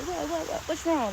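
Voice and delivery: high, quick imitation of a worried passer-by; concerned checking-in. Hiker imitating the lady who checked on her, concerned. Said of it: "checking on me and everything".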